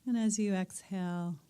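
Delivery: calm meditation teacher settling the room; guided meditation. Acted. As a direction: ease the group gently into stillness. Guides breathing and listening.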